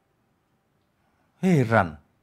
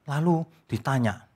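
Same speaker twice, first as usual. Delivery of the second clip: ordinary delivery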